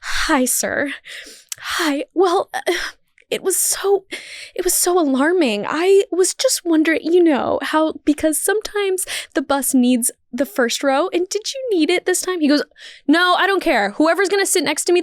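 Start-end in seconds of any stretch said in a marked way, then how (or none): 0.0-12.4 sickly sweet higher voice for the authority figure; old woman acting innocent to the bus driver, pitched up; putting on a show. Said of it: "the old woman starts turning on the show". 13.1-15.0 pissed-off driver. Retold or imitated. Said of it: "he's already pissed off".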